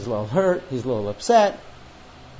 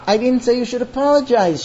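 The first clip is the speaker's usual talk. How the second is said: higher, raised defensive protest; 6 semitones higher, 4 dB louder than usual. Acted. Crying wife answering husband's rant, protesting. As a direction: push back against the accusation.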